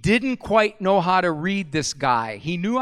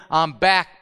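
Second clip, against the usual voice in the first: ordinary delivery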